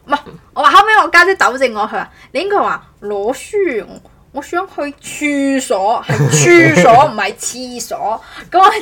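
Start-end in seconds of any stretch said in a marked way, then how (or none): none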